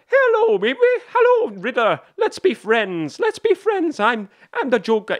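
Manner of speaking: very high, loud mock villain voice; Joker. Fan imitating Joker greeting the Riddler, hammed-up mock villain voice. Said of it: "of the Joker at the end, and he's like".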